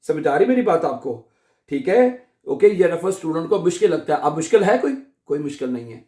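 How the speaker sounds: normal talking voice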